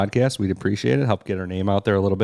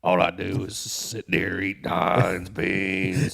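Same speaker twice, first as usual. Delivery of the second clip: frog voice; much slower than usual. Pretend. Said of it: "I just talk like this".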